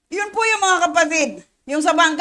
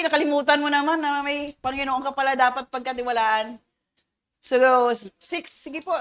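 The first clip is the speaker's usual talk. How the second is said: normal talking voice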